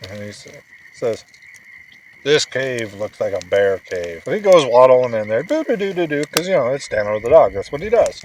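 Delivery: put-on telling a bedtime story to a sleepy child; bedtime story narration. A narrator's reading. Dad reading a bedtime story to his son, animated storytelling.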